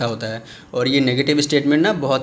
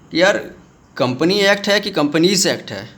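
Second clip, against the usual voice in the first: ordinary talk, nothing marked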